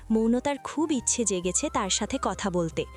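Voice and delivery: ordinary delivery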